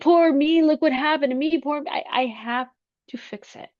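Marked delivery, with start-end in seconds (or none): 0.0-1.9 whiny mock self-pity, raised; mock a doom and gloom voice. Mimicking her old self-pitying self. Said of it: "doom and gloom person".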